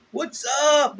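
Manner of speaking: normal talking voice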